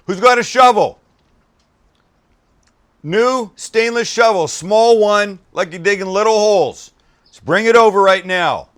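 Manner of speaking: loud, high calling out; excited shout. Real, not acted. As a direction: holler for a shovel, thrilled. Overwhelmed by flavour, calls out.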